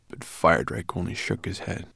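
plain reading voice

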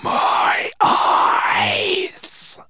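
breathy, voiceless monster whisper. Acted. Monster hypnotising the dragon, whispering. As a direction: lure him in with a whisper. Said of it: "whispered the yellow monster".

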